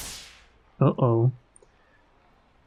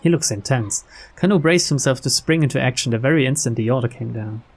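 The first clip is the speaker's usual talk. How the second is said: ordinary talk, nothing marked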